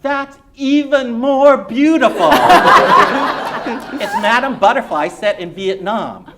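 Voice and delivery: loud, high, laughing dad; a father swept up with enthusiasm; delighted immigrant father. Acted. Reader voices the immigrant father.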